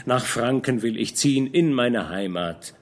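ordinary delivery

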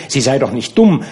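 plain reading voice

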